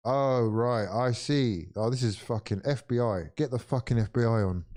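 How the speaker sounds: usual speaking voice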